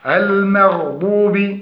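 المغضوب said slowly with a still ghayn; correct recitation. Shown as a demonstration. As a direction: hold the ghayn in المغضوب without bounce. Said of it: "الصواب". (نطق صحيح بسكون الغين)

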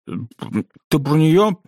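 high, drawn-out words round a mouthful; mouth full of toothpaste. A narrator's reading. Boy asks his roommate with toothpaste in his mouth, mouth full. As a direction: talk through a mouthful of toothpaste. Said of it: "с ртом, полным зубной пасты, спросил".

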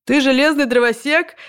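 put-on high, slow, puzzled old man; interrupting grandfather. A narrator's reading. Grandfather interrupting at the robot costume, puzzled. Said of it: "перебил её другой дедушка".

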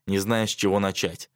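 usual speaking voice

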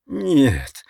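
high, anguished moan; moaned protest. A narrator's reading. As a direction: groan it in distress. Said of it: "простонал Ричард".

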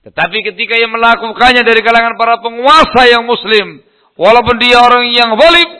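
loud, high, forceful sermon delivery; full-force preaching. Real, not acted. Preacher warns of judgement before God, full-force preaching.

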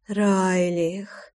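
soft, purred name with a rising end; purring. A narrator's reading. Лой calls her lover by name, purring softly. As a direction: purr РАЙЛИХ softly, lifting at the end. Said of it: "замурлыкала Лой".

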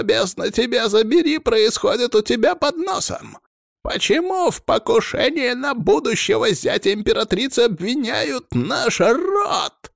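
furious high-pitched yell down the phone; high, strained tirade; enraged shouting. A narrator's reading. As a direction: shout in outrage, stretching the final words. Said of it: "Прокричал в трубку разъярённый голос".

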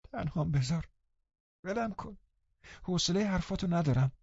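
high, soft, fretful brush-off; peevish dismissal. A narrator's reading. Linton telling Catherine to leave him alone, peevishly. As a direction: push them away in a weak, high voice.

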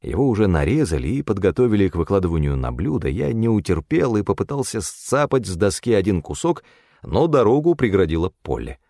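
ordinary delivery, nothing marked

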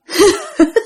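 high eerie ghostly giggle; a spooky cackle from outside; eerie laugh. Retold or imitated. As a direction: ghost woman laughing at the wall. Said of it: "giọng này nói rất là trầm".

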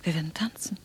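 soft, voiceless pleading; whispering. Acted. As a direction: beg in a whisper. Anna whispers her plea. (leise flehendes Flüstern)

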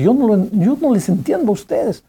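put-on grieving father lashing out at the singing girl; aggressive reproach. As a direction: hurl the blame at the girl. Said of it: "le dijo a la chica agresivamente".

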